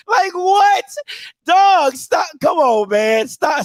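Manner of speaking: ordinary delivery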